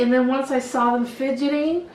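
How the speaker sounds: ordinary talk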